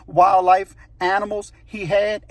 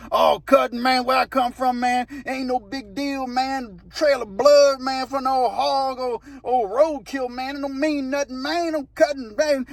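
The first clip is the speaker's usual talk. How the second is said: higher-pitched mock grumbling of a pissed-off coworker; imitating coworker's talk; 6 semitones higher, slower than usual. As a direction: mimic his drawn-out, put-out protest, pitched up. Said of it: "That's how he talked".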